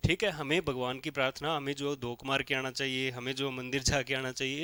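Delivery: ordinary talk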